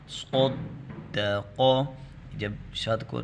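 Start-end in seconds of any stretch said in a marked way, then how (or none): 0.1-1.6 صدق with the doubled د held and pressed hard, very slow; heavy stressed doubling. Shown as a demonstration. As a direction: pause and press firmly on the shadda in صدق. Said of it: "اس کو ہم موٹا کریں گے". (صدق میں شد پر رک کر سختی سے)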